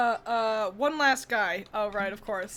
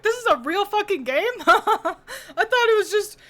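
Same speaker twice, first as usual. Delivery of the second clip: high, loud, laughing disbelief; shocked disbelief; 9 semitones higher, 7 dB louder than usual. Retold or imitated. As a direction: blurt it out stunned and laughing. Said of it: "and I was like".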